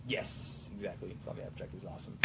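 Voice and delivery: whispery put-on character voice. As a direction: voice a pony character quietly. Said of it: "I'm gonna use my normal voice".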